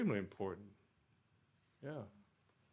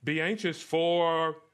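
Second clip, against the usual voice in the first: loud, raised scripture proclamation; emphatic preaching; 5 semitones higher, 6 dB louder than usual. Acted. Preacher expounding Philippians four to the congregation, emphatic preaching. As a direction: preach the verse out with strong projected stresses.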